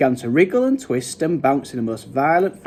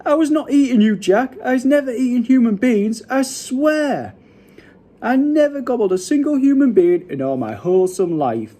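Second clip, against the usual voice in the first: high-pitched frantic protest; screeching; 10 semitones higher, 4 dB louder than usual. A narrator's reading. Giant swears to Jack he's innocent, screeching. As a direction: protest high and raised, as if for your life. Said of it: "he screeched".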